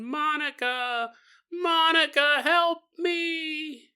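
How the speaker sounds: high, drawn-out mock cry; wailing, stretched-out plea; calling for help. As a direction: imitate a child echoing a far-away call. Child imitates man calling for help.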